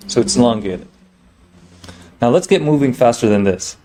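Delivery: ordinary delivery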